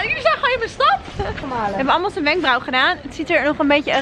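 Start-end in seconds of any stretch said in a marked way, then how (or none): 0.0-1.0 excited. Real, not acted. Excited about hometown.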